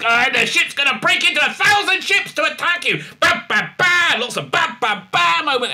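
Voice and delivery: high loud hammed-up action voice; mock action hype. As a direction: yell the mock explosion. Parody of action finale.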